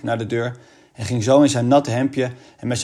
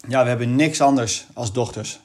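ordinary delivery

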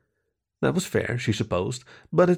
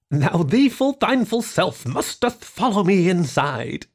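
slow high hammed-up archaic speech; mock olde English; 7 semitones higher, slower than usual. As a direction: play at being a solemn wizard. Girl plays mock olde English.

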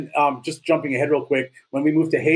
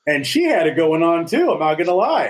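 normal talking voice

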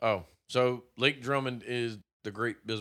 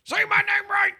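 shouting; 8 dB louder than usual. Acted. Mock demand, name said wrong.

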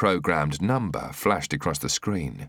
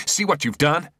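angry high shout; 10 semitones higher, 4 dB louder than usual. A narrator's reading. Shooter shouting at O'Leary over his mistake. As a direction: yell the blame at him. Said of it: "he shouted".

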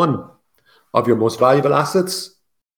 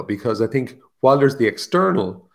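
normal talking voice

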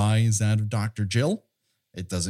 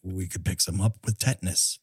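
John pitching to Disney; 5 semitones lower than usual. Acted. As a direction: lower put-on voice defending his idea. Acts John's reply to Disney.

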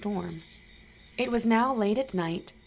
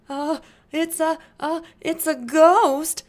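higher, louder, slow stammering fright; frightened cry; 8 semitones higher, 8 dB louder than usual. Acted. Disciples on the boat cry out at the ghost, frightened. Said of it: "they cried out in fear".

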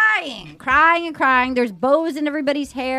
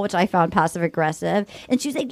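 ordinary delivery

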